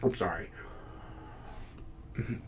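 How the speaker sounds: very low, barely voiced; abashed apology. Critic who lost his track apologizing to listeners, abashed. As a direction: shrink away, halting and hushed.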